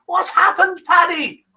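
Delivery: very high-pitched anxious mother-in-law; hammed-up anxious question. A narrator's reading. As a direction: fret it out in a high voice. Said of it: "she asked anxiously".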